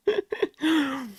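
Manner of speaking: a sudden high laugh; high squeal of laughter; high burst of laughter. Real, not acted. Laughs at own story.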